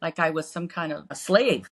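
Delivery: ordinary talk, nothing marked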